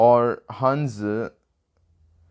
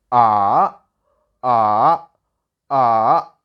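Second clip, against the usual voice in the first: "ah" dipping low, then climbing high; third tone; 2 semitones lower, 5 dB louder, much slower than usual. Shown as a demonstration. Teacher demonstrates Chinese third tone, "ah" falling then rising. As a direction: drop "ah" down and swing it back up, firmly. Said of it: "make your voice from high to low".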